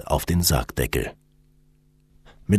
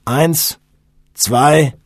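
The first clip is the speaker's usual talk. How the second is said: loud count with pauses between; high, spaced-out call on each number; 8 semitones higher, 7 dB louder, much slower than usual. A narrator's reading. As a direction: count the team in for a lift. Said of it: "zählte Robbo".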